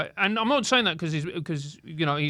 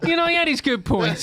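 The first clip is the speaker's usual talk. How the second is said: laughing; 4 semitones higher than usual. Real, not acted. Ironic joke, laughing.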